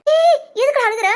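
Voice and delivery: sharp, high scolding; scolding mother. Acted. Mother demanding why her son is crying, scolding. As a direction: snap at a crying child.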